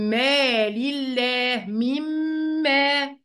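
very slow "مال الله"، then "من ما" as mimm-maa; nun merged into mim. Shown as a demonstration. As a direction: hold a long doubled m in "من ما". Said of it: "والنون لغيناها".

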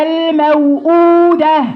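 الموءودة slow, the hamza pulled from deep in the throat. Shown as a demonstration. Teacher leading her student in a slow, careful recitation. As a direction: chant الموءودة slowly with a firm glottal hamza. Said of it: "يلا معايا".

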